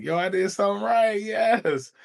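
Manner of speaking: high-pitched self-congratulating cheer with a laugh; playful high "yo, yes!" celebration; mock cheer. Imagined self cheering success.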